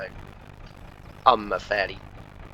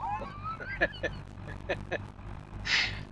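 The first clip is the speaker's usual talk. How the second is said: high laugh; 9 dB quieter than usual. Real, not acted. Laughing at mate's corkscrew remark.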